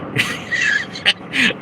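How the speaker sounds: breathy chuckle, mostly voiceless; stifled laugh at a tasteless joke; real laughter. Real, not acted. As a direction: laugh helplessly at your own crude joke. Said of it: "I'm laughing about it".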